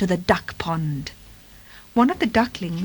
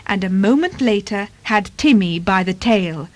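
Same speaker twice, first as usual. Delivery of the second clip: ordinary delivery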